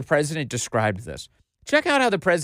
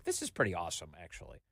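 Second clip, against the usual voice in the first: imagined aside, much softer and lower; quiet appreciation; 9 semitones lower, 11 dB quieter, faster than usual. Retold or imitated. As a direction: drop it low, as if musing to yourself. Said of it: "and be like".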